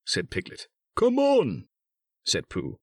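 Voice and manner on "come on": high, loud eager call; excited urging. A narrator's reading. Pooh hurrying Piglet to meet friend, excited. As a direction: urge a friend to hurry along.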